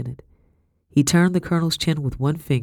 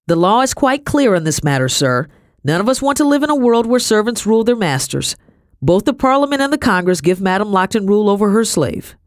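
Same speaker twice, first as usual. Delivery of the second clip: higher, louder pompous pronouncement; self-assured gentleman, lecturing; 7 semitones higher, 5 dB louder than usual. A narrator's reading. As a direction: lay down the law for the colonel. Voices Madame's gentleman.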